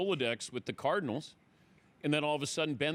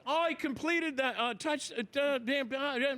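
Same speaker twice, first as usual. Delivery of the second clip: flustered panic; 10 semitones higher than usual. Retold or imitated. Broadcaster botching a live sign-off, high flustered stammer. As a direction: fumble for words and bail out.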